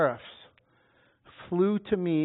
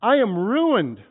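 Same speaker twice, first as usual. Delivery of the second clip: despairing cry; 5 semitones higher, 8 dB louder than usual. Acted. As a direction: mourn it out in ruin. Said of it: "I cried".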